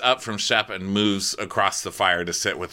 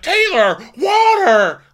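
very high, loud bark; mocking Shep ordering his girlfriend, very high and loud; mock shouted demand; 7 dB louder, slower than usual. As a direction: yell an order. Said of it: "He's like".

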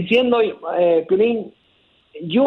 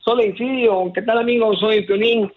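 bright, higher host greeting; sample greeting; 4 semitones higher than usual. Shown as a demonstration. Caller showing the host his signature greeting. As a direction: greet the audience like a radio star. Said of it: "Acento en la U".

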